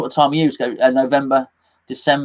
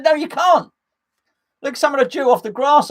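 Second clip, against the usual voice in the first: high-pitched mock-indignant dad; retold refusal, pitched right up in exasperation; exasperated refusal; 9 semitones higher than usual. Dad refusing his daughter a drink, mock exasperation.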